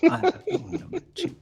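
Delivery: mock outrage from a pained captive; pained, indignant captive. Restrained lord answers his interrogator, baffled mock indignation. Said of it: "winces in pain and looks back and says".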